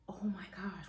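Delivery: breathy whispered gasp of shock; retold whisper. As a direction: whisper it in startled disbelief. Retold shock at mucus plug.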